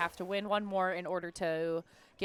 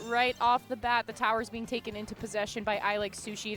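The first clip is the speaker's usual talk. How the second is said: ordinary talk, nothing marked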